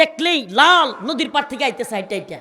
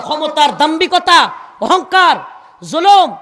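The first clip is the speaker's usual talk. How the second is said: each word hurled out louder; loud, indignant preaching; emphatic denunciation; 4 semitones higher, 9 dB louder than usual. Real, not acted. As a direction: thunder the list of sins at the crowd. Preacher denouncing arrogance and oppression.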